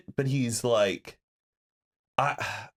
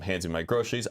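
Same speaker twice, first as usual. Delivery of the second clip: usual speaking voice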